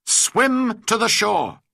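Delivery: loud, high, drawn-out shout; cried out. A narrator's reading. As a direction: call the order out slowly over the water. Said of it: "I cried".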